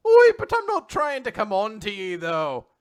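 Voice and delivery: high, loud hammy Irish. Pretend. Captain telling the women in stage Irish he's not flirting. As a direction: protest innocence in stage Irish. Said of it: "a stereotypical Irish guy".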